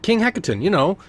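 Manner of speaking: high boastful sailor voice; boasting recruiter. Acted. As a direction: show off the prize, proud of it.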